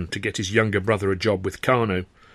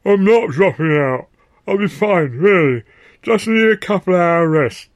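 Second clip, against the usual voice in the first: insisting; 9 semitones higher, 8 dB louder than usual. Acted. Arthur insisting despite injuries.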